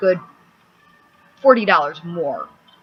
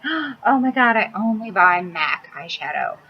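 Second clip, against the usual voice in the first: mocking makeup snob. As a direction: gush like a snobby makeup girl, pitched up. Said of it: "all those girls out there like".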